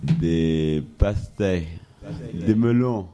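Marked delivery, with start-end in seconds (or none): none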